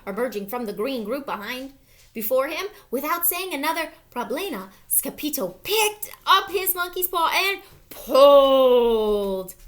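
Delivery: ordinary delivery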